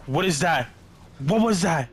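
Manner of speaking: high loud startled yelp; jolted by a sudden noise. Real, not acted. Sudden scare in horror game.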